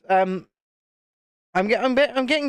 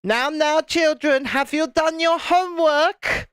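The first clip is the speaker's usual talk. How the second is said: strict teacher; 6 semitones higher, slower than usual. Retold or imitated. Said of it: "starts going".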